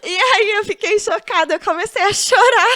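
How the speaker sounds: high, loud, teary laughing through the story; crying with laughter. Real, not acted. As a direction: fall apart laughing as you tell it.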